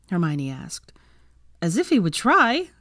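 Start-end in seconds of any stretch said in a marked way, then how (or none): 1.6-2.7 higher-pitched mock disbelief; scoffing. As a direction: scoff at the very idea. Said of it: "Ginny scoffed".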